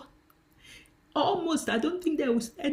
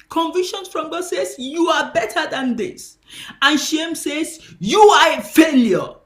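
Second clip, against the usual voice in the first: preaching at full force, louder and higher; 4 semitones higher, 10 dB louder than usual. Acted. Preacher warning the church against shame, loud preaching. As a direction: hammer the contrast home.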